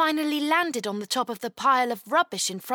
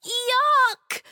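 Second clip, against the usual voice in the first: high, loud squeal of disgust; disgusted little girl, pitched high; disgusted yelp; 12 semitones higher, 5 dB louder than usual. A narrator's reading. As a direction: recoil from a foul smell, high-voiced. Disgusted at whiff of bin.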